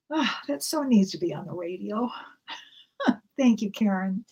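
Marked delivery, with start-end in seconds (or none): none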